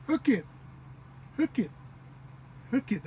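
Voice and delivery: "hook it" as two short syllables, pitched high, stress on hook; shorter dialect form. Shown as a demonstration. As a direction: say "hook it" with no final -ee. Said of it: "a little bit shorter".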